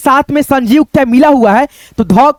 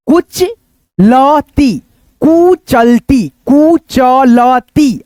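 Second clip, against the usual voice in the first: कुचलती very slow, each syllable drawn out; slow syllable reading; much slower than usual. Shown as a demonstration. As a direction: drag out कुचलती for students. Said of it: "फिर यहाँ पे देखो". (कुचलती को बहुत धीरे-धीरे)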